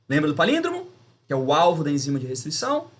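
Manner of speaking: ordinary talk, nothing marked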